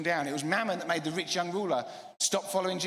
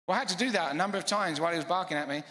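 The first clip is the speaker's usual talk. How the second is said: ordinary talk, nothing marked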